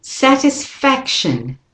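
"Satisfaction" slow, stress on fac, weak tis and shun; slow model word. Shown as a demonstration. Teacher leads learners in saying it, slow and clear. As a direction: drag out "Satisfaction" for copying. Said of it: "do it with me".

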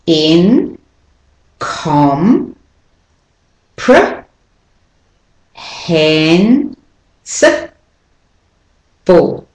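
"Incomprehensible" very slowly, each syllable alone with long gaps. Shown as a demonstration. Teacher showing the stressed syllables, very slow and separated. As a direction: break "Incomprehensible" into six isolated syllables, stressed ones drawn out. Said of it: "So the stressed syllables are".

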